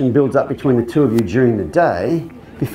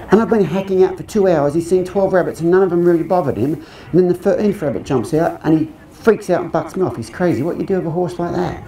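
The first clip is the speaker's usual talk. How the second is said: Cockney impression of the lady, higher pitched and thickening; growing cockney accent; 5 semitones higher than usual. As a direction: let the Cockney get broader as the story goes. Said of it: "This accent gets more and more cockney".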